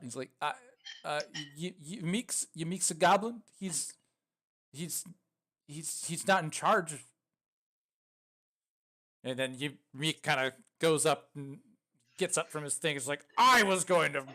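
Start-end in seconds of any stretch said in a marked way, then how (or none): 0.4-6.9 higher, hesitant goblin stammer; stuttering it out. Acted. Droop answering who Yamik is, stuttering. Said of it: "like, stutters it out". 13.4-14.2 high, loud indignant goblin outburst; loud protest. Acted. Caged goblin getting up to object, loud protest.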